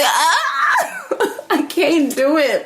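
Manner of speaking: high laughing outburst. Retold or imitated. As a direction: crack up, can't hold it. Her own reaction, cracking up.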